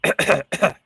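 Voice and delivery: rough cough clearing the throat. Real, not acted. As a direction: hack it up. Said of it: "A bug just flew in my mouth".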